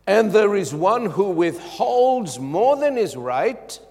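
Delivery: slow, weighty reading of the verse; slow scripture reading. Acted. Preacher reading the proverb to the congregation, slow and weighty. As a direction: read the proverb slowly and deliberately.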